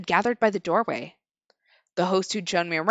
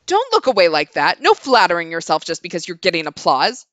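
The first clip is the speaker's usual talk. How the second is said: stern loud scold; 4 semitones lower, 9 dB louder than usual. Acted. Woman scolds again.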